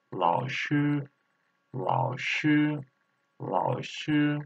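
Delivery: 老师 said slowly, third tone then first tone; tone drill. Shown as a demonstration. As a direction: model 老师 tone by tone for learners. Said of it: "Third tone and first tone".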